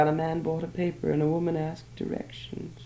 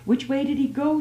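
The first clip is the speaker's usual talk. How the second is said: eager question, high and louder; eagerly; 10 semitones higher, 4 dB louder than usual. A narrator's reading. Woman asks the newsdealer which way, eager and high. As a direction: leap at the news. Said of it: "she said eagerly".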